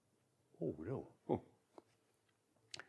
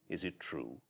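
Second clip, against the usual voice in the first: softer, lower Is it true; hushed disbelief; 7 semitones lower, 10 dB quieter than usual. Acted. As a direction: barely dare to ask.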